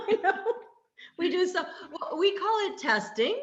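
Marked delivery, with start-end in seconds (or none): 0.0-2.2 laughing. Real, not acted. Laughing at being teased.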